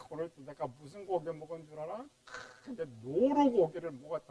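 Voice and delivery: smug bragging aside; preacher imitating a boaster, lightly voiced; mock boasting. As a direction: show off the venison like a braggart. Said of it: "여기 자랑 뭐예요?".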